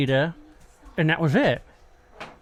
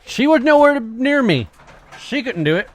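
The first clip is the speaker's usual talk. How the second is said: higher and louder, insisting hard; emphatic insistence; 8 semitones higher, 6 dB louder than usual. Real, not acted. Defending his cameraman, much higher and louder insistence. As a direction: stress that she was nowhere near.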